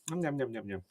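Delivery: soft nom-nom eating noises; imitated munching. Retelling eating the offered birthday cake, imitated munching. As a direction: quietly mimic gobbling food.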